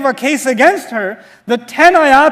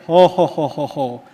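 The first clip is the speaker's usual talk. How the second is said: amused chuckle; 9 semitones lower than usual. Real, not acted. Laughing at the story.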